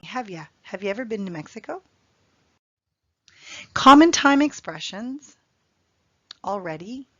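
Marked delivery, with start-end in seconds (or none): 0.0-1.8 fast casual have ya; contracted have ya. Shown as a demonstration. Teacher repeating the relaxed native question. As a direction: Have you run together as have ya, quick. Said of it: "we like to contract the question".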